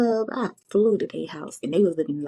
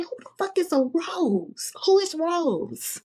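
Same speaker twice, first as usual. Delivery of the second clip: high, slow outraged; 11 semitones higher, slower than usual. Retold or imitated. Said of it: "I said".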